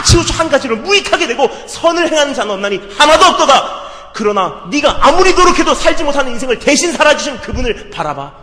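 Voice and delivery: fervent sermon cry over scripture; preaching at full force. Acted. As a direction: proclaim the verses, pleading.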